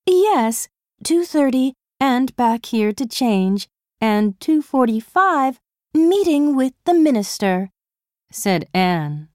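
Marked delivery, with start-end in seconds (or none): 0.1-7.7 princess voice. Acted. Anne listing royal schedule.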